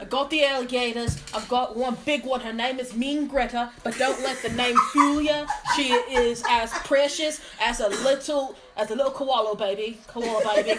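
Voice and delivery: broad, put-on Australian accent. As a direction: play a cheerful Aussie mate. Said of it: "I'll do my Australian accent".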